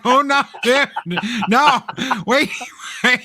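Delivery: high, loud, fast and laughing; excited warning. Retold or imitated. Telling Pops it gets worse, laughing and fast. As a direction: gleefully warn a friend. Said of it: "And I was like".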